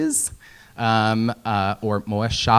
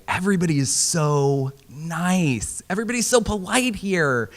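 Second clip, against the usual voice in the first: sugary Midwestern local; 5 semitones higher, 4 dB quieter than usual. Retold or imitated. Midwesterners gushing over a visitor, sugary high voice. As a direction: gush like an overly polite local. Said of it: "a lot of people will say".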